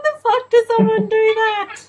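loud, high disbelieving yell; shocked outburst. Real, not acted. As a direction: blurt it out in real shock. Shocked reaction to movie scene.

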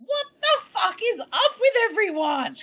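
high-pitched exasperated protest; exasperated disbelief. Acted. Viewer reacting to everyone at camp, exasperated. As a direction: pitch it right up, baffled and fed up.

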